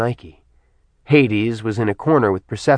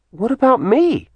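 high, wounded protest; hurt protest; 13 semitones higher than usual. Acted. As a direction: cry out against the neglect.

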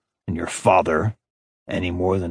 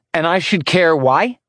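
higher, scornful challenge; bitter retort; 7 semitones higher than usual. A narrator's reading. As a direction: throw the question back with scorn.

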